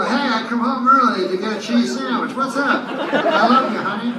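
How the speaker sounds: high, loud, gushing hello to a lover; sweet homecoming greeting. Retold or imitated. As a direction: coo it like you just walked in the door. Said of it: "And you go".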